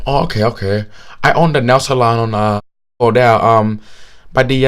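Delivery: hesitant pitch in a mock Vietnamese accent. Nail salon owner asking him out, imitated Vietnamese accent. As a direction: imitate a man fumbling an invitation, Vietnamese accent. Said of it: "thick, thick Vietnamese accent".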